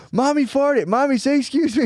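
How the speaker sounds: very high-pitched little-kid impression; Maya. Little daughter announcing on the plane that mommy farted, mimicked child voice. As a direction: pipe up like a small child insisting on manners. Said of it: "I knew she would go".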